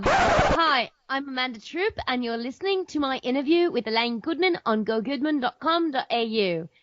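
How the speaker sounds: attempted Australian accent that slides toward British; a shaky try at an Australian accent. Podcaster copying an Australian accent for the intro. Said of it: "Say it in an Australian accent".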